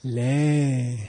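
long drawn-out 'Le'; longest length. Shown as a demonstration. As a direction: stretch the vowel of 'Le' right out. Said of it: "three different lengths of it".

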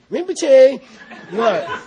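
loud, high call of Rinpoche, what; interrupting the teacher mid-ceremony with a laugh; hammed-up indignant student; mock indignant call. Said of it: "he'll say".

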